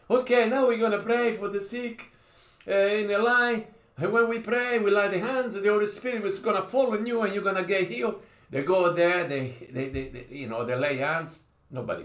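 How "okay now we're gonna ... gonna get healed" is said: a lightly hammed-up preacher, pitched higher; mock pastor. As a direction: imitate an eager healing-line pastor. Mimics a pastor at healing line.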